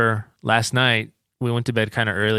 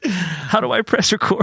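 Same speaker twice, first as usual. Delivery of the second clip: mock confused; 10 semitones higher, 5 dB louder than usual.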